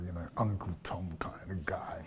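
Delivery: hushed conspiratorial whisper; sly half-whispered jab; whispered aside. Real, not acted. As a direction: drop to a whisper as if sharing a secret. Sly aside about councillor.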